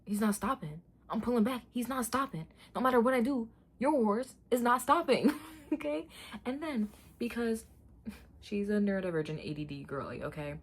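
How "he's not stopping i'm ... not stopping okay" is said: higher and louder, pleading; frustrated. Real, not acted. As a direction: insist in frustration. Frustrated protest about the horse.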